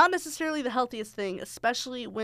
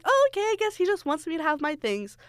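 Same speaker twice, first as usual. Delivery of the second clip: high, innocent mock-clueless voice; naive younger self; 7 semitones higher than usual. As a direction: play your oblivious teenage self. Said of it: "stupid [name] at the time was just like".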